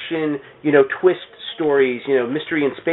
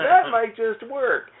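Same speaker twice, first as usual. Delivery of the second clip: mock eureka; 8 semitones higher, 3 dB quieter, much slower than usual. Comic writer on vacation finds his idea, hammed-up excitement. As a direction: pitch jumps up, mock excited discovery. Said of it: "and was like".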